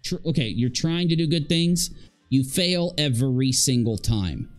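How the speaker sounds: ordinary talk, nothing marked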